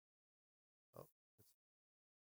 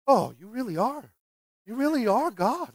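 soft, swooping, awestruck disciples; mock amazement; 6 dB quieter than usual. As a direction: gush it in hammed-up wonder. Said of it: "and they said".

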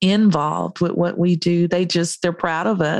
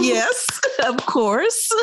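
laughing; 8 semitones higher, slower than usual. Real, not acted. Laughs at self-promotion.

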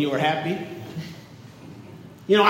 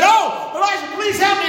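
widow's high plea; 11 semitones higher than usual. Acted. Widow begging the prophet Elijah for help, high desperate plea. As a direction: lift the voice up high and beg.

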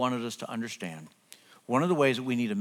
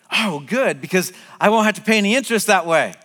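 higher, louder, pleased relief; delighted son; 5 semitones higher, 6 dB louder than usual. Retold or imitated. Son replying to dad's loan offer, delighted. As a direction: imitate a son happily dodging interest. Acts out son's relieved response.

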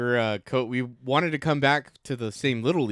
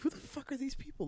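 incredulous; 12 semitones higher, 14 dB quieter than usual. Retold or imitated. Retelling outrage at league officials.

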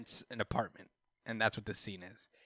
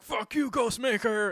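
high, loud, drawn-out defiance; hammed-up battle challenge; mock angry yell; 11 semitones higher, 6 dB louder, slower than usual. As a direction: yell it like a cartoon hero. Batman defies rival in retelling.

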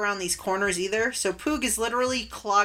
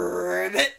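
rolled, lower-pitched frog croak; frog ribbit; 4 semitones lower than usual. Acted. Large frog answers from inside the cabin, croaking. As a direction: imitate a big frog's croak. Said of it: "you hear from inside the cabin".

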